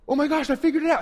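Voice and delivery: gasp, then high excited burst; sudden realization. Acted. Acting out sudden idea in shower.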